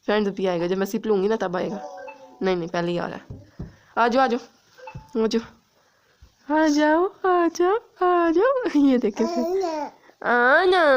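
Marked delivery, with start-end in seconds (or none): 4.0-10.8 high, loud pleading call to the dog; calling the dog over, high and loud; excited calling. Real, not acted. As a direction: call the puppy over, higher on each try.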